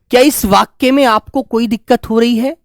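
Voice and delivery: ordinary delivery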